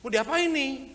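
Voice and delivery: a touch of baffled treasurer; puzzled. Retold or imitated. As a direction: wonder aloud what on earth to do with it.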